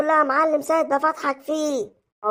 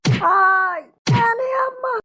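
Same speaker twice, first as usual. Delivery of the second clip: high wailing cry for mother; pained wail; 10 semitones higher, slower than usual. Acted. Beaten henchman wailing for his mother. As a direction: wail in pain, calling for mother.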